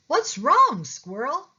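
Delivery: high, loud concerned question; concerned cat call. A narrator's reading. Pete asking his glum friend Squirrel, high and caring. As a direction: call out to a friend who looks down.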